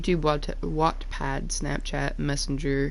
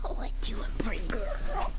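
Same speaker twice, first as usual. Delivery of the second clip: whispered pet talk; 2 semitones higher, 3 dB quieter than usual. Real, not acted. Owner whispering sweetly to her dog. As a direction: breathe it to the dog.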